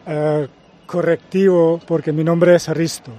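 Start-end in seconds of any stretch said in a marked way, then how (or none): 2.7-3.1 Risto begun with a plain R; name pronunciation. Shown as a demonstration. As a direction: say Risto with no H sound before the R. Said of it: "Se pronuncia Risto". (Risto, pronunciado con erre, sin hache)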